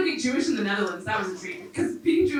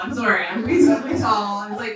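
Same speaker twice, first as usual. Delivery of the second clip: ordinary talk, nothing marked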